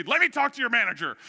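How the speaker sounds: loud, high mock tantrum; hammed-up irate customer outburst; retold angry demand. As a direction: demand it hotly, acting out the angry caller. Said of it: "I'm getting angry and angry".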